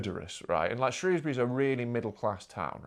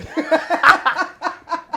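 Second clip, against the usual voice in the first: burst of laughter; 9 dB louder than usual. Real, not acted. Laughs at builder's punchline.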